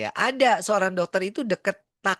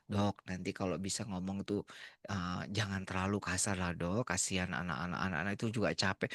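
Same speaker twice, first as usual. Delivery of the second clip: much softer, lower, coaxing plea; soft pleading; 9 semitones lower, 10 dB quieter than usual. Retold or imitated. Nurse quietly pleading with the doctor about the staff. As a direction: gently beg him to go easy.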